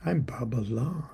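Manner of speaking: breathy whispered refrain. Acted. Reciting his poem, a hushed whispered refrain. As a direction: whisper the name like a secret.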